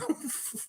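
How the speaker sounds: high breathy snicker; laugh. Real, not acted. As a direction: snicker softly at your own innuendo. Laughs at Raptor freaks joke.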